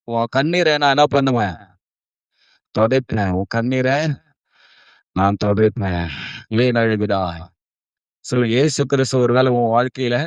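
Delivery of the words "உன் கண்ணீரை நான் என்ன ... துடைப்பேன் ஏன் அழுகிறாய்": low, gentle consoling voice; tender comforting. Acted. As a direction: drop low to console someone in tears. Voices Jesus comforting a weeping woman. (கனிவான தாழ்ந்த ஆறுதல் குரல்)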